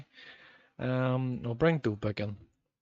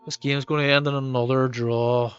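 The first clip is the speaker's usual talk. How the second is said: louder exasperated complaint; frustrated outburst; 9 dB louder than usual. Real, not acted. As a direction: vent at the game going wrong. Frustrated at the match.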